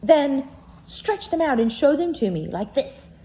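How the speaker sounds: wild rabbit daring. Acted. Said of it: "said the wild rabbit".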